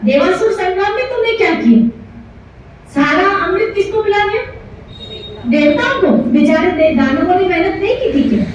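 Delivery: high-pitched scolding, acted indignation; angry accusation. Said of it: "चिल्ला रहा है". (क्रोध में ऊँचे स्वर में उलाहना)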